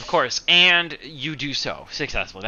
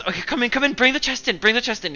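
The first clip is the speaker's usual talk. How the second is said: giddy; 10 semitones higher, faster than usual. Acted. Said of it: "a little excited, like a little giddy".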